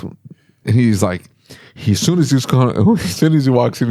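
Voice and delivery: ordinary talk, nothing marked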